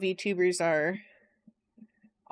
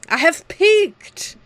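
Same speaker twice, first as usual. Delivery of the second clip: slow, high, louder grand declaration; mock dramatic; 7 semitones higher, 6 dB louder, much slower than usual. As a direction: declare it like a triumph. Dramatic joke about content.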